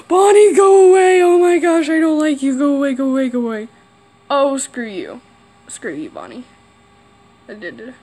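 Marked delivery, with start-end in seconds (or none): 0.0-3.7 scared cry of go away, higher and louder; frightened shout. Real, not acted. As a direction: shoo off the monster in real fright. Animatronic approaching player.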